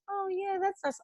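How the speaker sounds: high, soft fake approval; two-faced niceness. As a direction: coo it sweetly, insincerely to their face. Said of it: "were you two-faced?".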